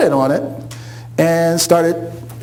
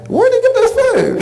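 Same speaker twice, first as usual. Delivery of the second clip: suspicious townsfolk. Retold or imitated. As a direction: squeak it out, suspicious. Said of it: "they're thinking".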